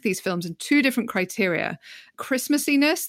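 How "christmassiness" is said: mock-technical term. Real, not acted. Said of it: "that's a very technical scientific term".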